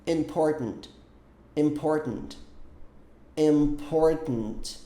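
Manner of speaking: "Important" with the T swallowed in the throat; "Important" with a throat-stopped T; American important. Shown as a demonstration. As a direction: say "Important" with a glottal stop for the T. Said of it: "Olha como é que pronuncia a palavra important".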